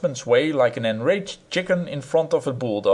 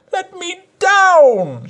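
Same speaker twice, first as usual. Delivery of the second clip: much higher, slow drawn-out wail; 7 dB louder, much slower than usual. A narrator's reading. Wife stuck on the pedestal, a high slow wail. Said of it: "she wailed".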